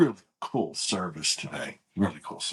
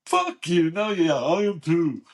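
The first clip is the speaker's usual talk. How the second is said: brother's joking comeback; 4 semitones higher, 5 dB louder, slower than usual. Retold or imitated. Brother joking back, retold.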